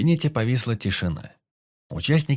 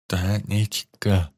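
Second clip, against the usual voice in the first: slurred hiss; much slower than usual. A narrator's reading. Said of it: "невнятно прошипел врач разорванным ртом".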